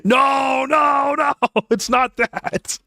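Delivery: high-pitched, laughing wail of denial; laughing protest. Real, not acted. Streamer denying a teasing accusation, high laughing protest. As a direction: cry out in high, drawn-out protest through laughter.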